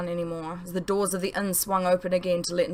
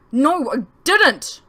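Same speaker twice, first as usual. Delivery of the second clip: loud, high, slow angry yell; yelled denial; 7 semitones higher, 8 dB louder, much slower than usual. Acted. Son yelling at his parents, angry loud denial. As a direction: throw it back at them in fury. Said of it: "I yelled at them".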